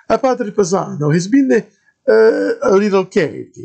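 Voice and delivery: put-on high, loud thick Italian accent of a friar; Italian-accented padre greeting a stranger. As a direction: play the monk in a heavy Italian accent. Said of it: "the thickest Italian accent I'd ever heard".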